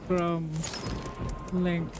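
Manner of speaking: solemn recital, word by word; put-on reading voice. Real, not acted. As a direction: read each word on its own in an odd put-on voice. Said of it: "I don't know why I'm using this voice".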